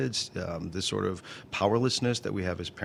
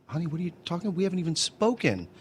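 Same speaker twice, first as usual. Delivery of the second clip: higher-pitched disbelieving dad, retold; baffled protest; 8 semitones higher than usual. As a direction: protest in bewilderment at an unfair charge. Retold protest to his daughter.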